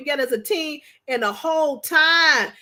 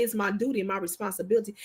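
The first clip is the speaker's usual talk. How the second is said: quieter, fast, lowered sincere promise; soft husband quote; 7 semitones lower, 8 dB quieter, faster than usual. Retold or imitated. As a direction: quietly vow it as her husband would. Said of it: "He say".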